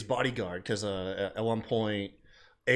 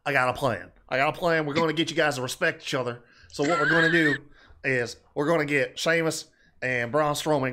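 loud, fast Southern twang impression of AJ; Southern accent; 4 semitones higher, 4 dB louder than usual. Mocking AJ's Southern accent on a podcast, loud fast Southern twang. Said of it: "you just did a full ass Southern accent".

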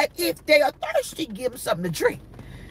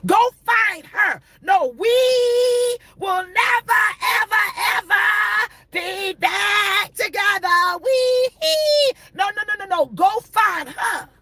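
shouted preaching; 7 semitones higher, slower than usual. Acted. Preacher telling the ex to go find her, slowly shouting. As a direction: stretch it out and let it ring.

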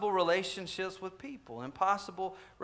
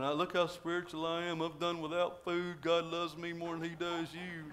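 a hint of a smug, self-pitying faster, softer; lightly mock the showy, holier-than-thou faster; mock pious moper; 6 dB quieter than usual. Preacher mocking hypocrites who mope while fasting, softer mock voice. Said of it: "kind of mope around going".

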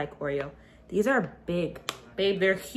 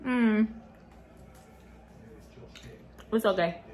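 chewing while talking; biting into soft treat; mouth full; 3 dB quieter than usual. Real, not acted. Seen 1: Bites treat before, mouth working at 0, bites again at 3.0.

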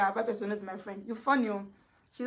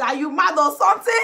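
mock taunt; 4 semitones higher, 8 dB louder than usual.

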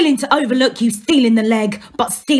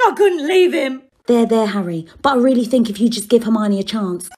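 usual speaking voice

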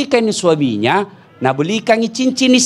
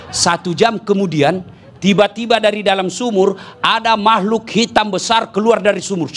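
ordinary talk

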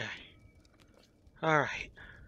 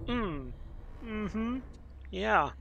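ordinary delivery

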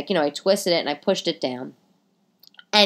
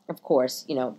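ordinary delivery

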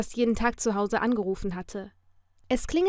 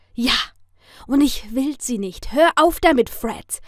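louder, rising to a high, forceful HÖR AUF; all too emphatic; 3 semitones higher, 8 dB louder than usual. A narrator's reading. She refuses her stalker's flowers, all too emphatically. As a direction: make the refusal unmistakably clear. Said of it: "sagte ich nur allzu deutlich".

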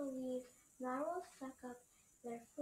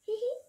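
giggle; 9 semitones higher, 10 dB louder than usual. Acted. Narwhal giggles in story.

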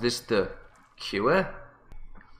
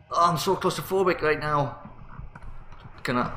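normal talking voice

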